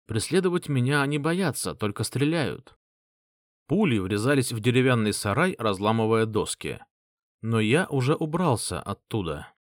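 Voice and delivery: ordinary delivery, nothing marked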